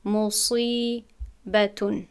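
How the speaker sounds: very slow مصیبتون, ص kept heavy before the i; drawn out heavy مصیبتون; slow emphatic; heavy sad with kasra. Shown as a demonstration.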